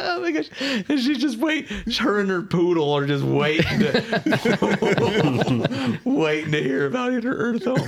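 laughing through the words; giggling while talking. Real, not acted. Cracking up about the story.

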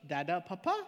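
small questioning lilt, rising at the end; little girl asking. Retold or imitated. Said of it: "she looks and she goes".

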